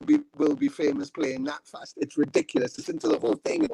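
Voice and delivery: playing the unimpressed bandmate, scornful and high; scoffing guitarist. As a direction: sneer at the music in a pinched high voice. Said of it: "my guitarist stood up and went".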